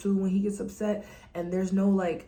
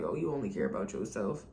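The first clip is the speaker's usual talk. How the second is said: low mock accusation; 9 semitones lower, 4 dB quieter than usual. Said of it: "it just feels like".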